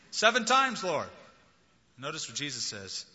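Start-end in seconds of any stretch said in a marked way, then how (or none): 0.1-1.1 high, loud boast of seven; proud offer. Retold or imitated. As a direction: announce it like a generous bid.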